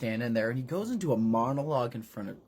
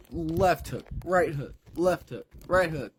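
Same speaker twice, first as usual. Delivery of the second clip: ordinary talk, nothing marked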